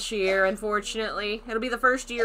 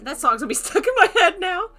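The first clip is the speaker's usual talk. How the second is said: laughing; 10 semitones higher, 9 dB louder than usual. Real, not acted. Laughing at the catchy song.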